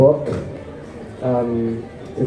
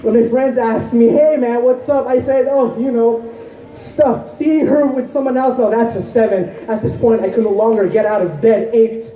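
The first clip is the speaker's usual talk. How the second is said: higher-pitched, urgent poetry recital; impassioned spoken-word poem; 10 semitones higher than usual. Acted. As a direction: pour out the heartbreak as slam poetry. Poem turns to personal heartbreak.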